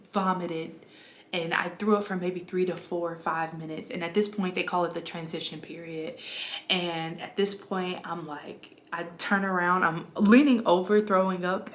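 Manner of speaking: usual speaking voice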